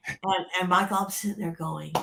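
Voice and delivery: ordinary talk, nothing marked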